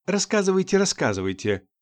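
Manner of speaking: high teasing singsong. A narrator's reading. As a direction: tease him from behind. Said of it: "начала дразниться". (дразнясь, нараспев)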